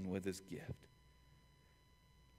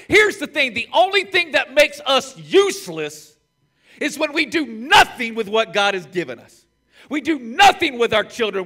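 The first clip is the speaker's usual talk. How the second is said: loud, high preacher's build; rising sermon climax; fiery preaching; 12 semitones higher, 15 dB louder than usual. Acted. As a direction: hammer it home from the pulpit. Preaching at full force.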